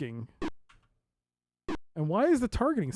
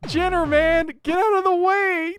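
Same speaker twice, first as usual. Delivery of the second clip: loud frustrated shout, much higher; frustrated yelling; 8 semitones higher, 7 dB louder than usual. Real, not acted. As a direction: yell at the teammate blocking you. Blocked by teammate, can't shoot.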